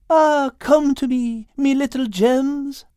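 high, slow character voice; high-pitched, savoring little fellow; delighted Al. Acted. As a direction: coo greedily over treasure, high and drawn out. Story character gloating over cocoa beans.

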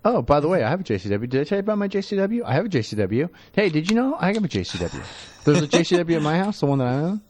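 smug, repeated boasting; bragging voice. Retold or imitated. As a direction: show off the new car over and over. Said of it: "Todd won't be the only one saying".

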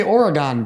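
Oregon said OR-uh-gahn; full "gahn" at the end of Oregon; New York pronunciation. Retold or imitated. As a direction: end Oregon on an open AH vowel. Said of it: "That's how New Yorkers say Oregon".

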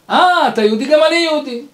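high-pitched "oh, you too?" recognition; retold excited discovery; delighted surprise. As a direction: act out finding one of your own.

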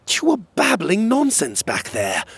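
high-pitched, incredulous accusation; baffled insistence. Acted. Challenging Magborn about the coded exchange, baffled.

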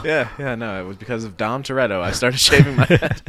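talking through laughter; laughing. Real, not acted. Giving a friend the cool story, laughing through it. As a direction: give the joke answer while cracking up.

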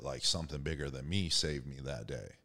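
ordinary talk

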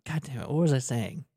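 ordinary talk, nothing marked

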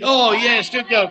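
ordinary talk

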